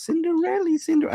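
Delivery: "Cinderella" sung out very high, then falling; a playful high call of "Cinderella"; Becky-style greeting. Retold or imitated. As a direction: pitch "Cinderella" way up and let it drop, like a sing-song hello. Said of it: "I don't do it like Becky".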